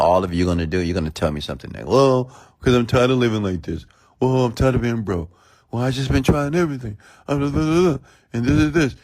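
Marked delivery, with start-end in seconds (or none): 1.9-9.0 much higher, whiny mock complaint; mock a grown-up whining about life; whiny complainer. Speaker mimicking listeners complaining about being broke, whiny and high. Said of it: "you know what you sound like?".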